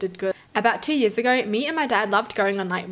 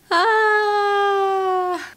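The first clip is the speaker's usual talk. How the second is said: loud, high, drawn-out wail of dread; sustained high aah, slowly sinking; long wailing cry; 11 semitones higher, 5 dB louder than usual. Real, not acted. Reader horrified by the intruder's return, wailing.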